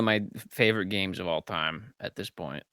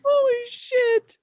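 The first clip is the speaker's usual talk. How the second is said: amazed; 5 dB louder, slower than usual. Real, not acted. Reacting after big laugh.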